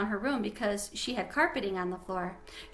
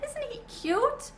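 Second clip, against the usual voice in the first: put-on much higher, slow, cooing little girl voice; gushing girl; slower than usual. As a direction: coo it over a cute pet.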